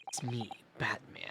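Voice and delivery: low gravelly Batman whisper; hushed dark-hero growl. Pretend. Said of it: "That's the wrong voice".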